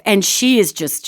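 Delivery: normal talking voice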